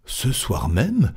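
slow question with pitch swooping up; surprised question. Acted. Stuart asks Fogg about tonight's departure, surprised. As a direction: ask it in drawn-out disbelief.

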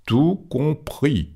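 very slow, high, measured answer; unruffled reply. Acted. Fogg answers Stuart's list of disasters, slow and unruffled. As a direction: draw out each syllable coolly.

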